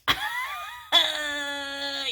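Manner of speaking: laughing outright, high; a real laugh at her own awkwardness; burst of laughter. Real, not acted. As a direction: break into a laugh. Laughing at awkward spirit demand.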